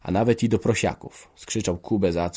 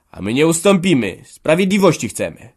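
loud, high-pitched demand; defiant; 7 semitones higher, 8 dB louder than usual. Acted. Children demand justice from father, defiantly. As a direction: stand firm and call it out.

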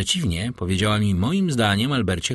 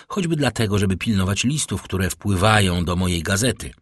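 plain reading voice

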